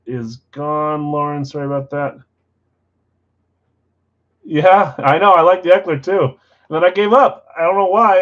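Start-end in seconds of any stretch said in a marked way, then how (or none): 4.4-8.2 loud, high and thrilled; excited outburst. Real, not acted. Card breaker to viewers after a hit, loud excited outburst. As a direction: burst out with real excitement.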